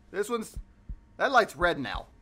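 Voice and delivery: usual speaking voice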